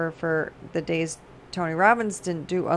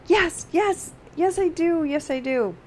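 much higher, eager repeated yeses; 9 semitones higher than usual. Retold or imitated. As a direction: agree with him, all fired up. Eager reply to the speaker.

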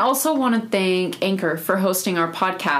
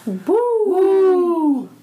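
cheering; 11 semitones higher, 4 dB louder than usual. Real, not acted. Cheering Romance Month.

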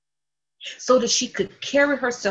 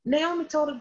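ordinary delivery

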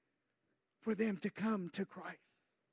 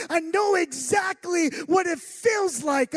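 I know exactly what it feels like, shouted loud and higher; fervent shout; 6 semitones higher, 9 dB louder than usual. Real, not acted. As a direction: belt it out to the church. Same words shouted in preaching.

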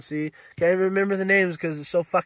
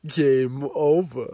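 game-over announcer; 2 semitones lower, much slower than usual. Retold or imitated. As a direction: intone it like a gloating arcade voice. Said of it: "that guy standing there going".

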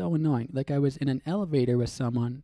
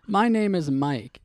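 normal talking voice

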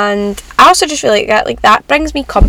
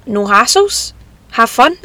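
normal talking voice

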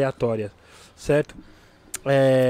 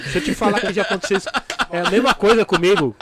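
fast, laughing and louder; 6 semitones higher, 5 dB louder than usual. Real, not acted. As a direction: chuckle through it. Laughing agreement.

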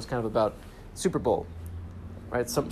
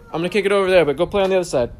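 raised voice, calling across the store; 6 semitones higher, 8 dB louder, faster than usual. Real, not acted. Calling over to mom and little sister, loud. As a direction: call over to them so they hear.

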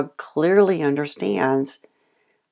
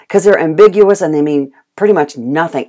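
ordinary talk, nothing marked

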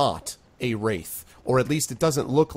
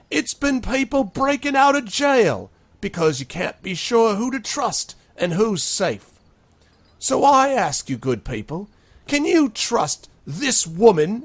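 high, loud demagogue working up a crowd; loud, high rally-speech voice; rabble-rousing speech; 11 semitones higher, 6 dB louder than usual. Acted. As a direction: stir the mob against the mayor. Sharky addresses the crowd.

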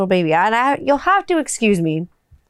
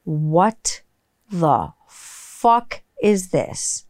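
slow, lower, drawn-out disgust; disgusted shock; 3 semitones lower, much slower than usual. Retold or imitated. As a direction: drag it out as the soap hits. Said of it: "and I'm like".

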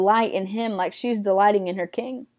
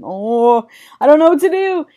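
higher, louder lovestruck fluster; mock freaking out; 4 semitones higher, 5 dB louder than usual. Lovesick woman sitting with her king, mock freaking out. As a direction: play her flustered, rising into a wail. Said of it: "like, freaking out".

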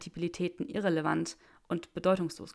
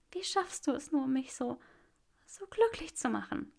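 higher, tearful happy woman; 7 semitones higher than usual. Acted. Granger laughs through tears at Draco, higher.

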